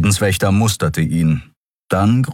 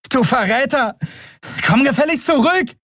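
high, angry call after a deserter; indignant shout. A narrator's reading. Endriel shouts after fleeing traitor, indignant high yell. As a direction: yell accusingly at someone running off.

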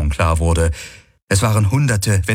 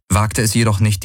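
ordinary delivery, nothing marked

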